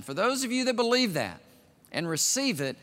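ordinary talk

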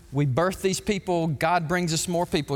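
ordinary delivery

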